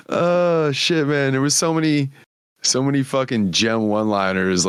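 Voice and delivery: ordinary talk, nothing marked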